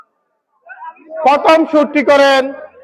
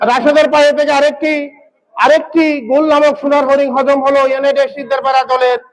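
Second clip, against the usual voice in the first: ordinary talk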